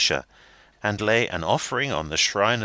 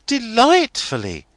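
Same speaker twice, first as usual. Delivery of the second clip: high, louder delighted exclamation; spontaneous cry; 8 dB louder than usual. A narrator's reading. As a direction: blurt it out with glee. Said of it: "cries Rosa, in a quite spontaneous manner".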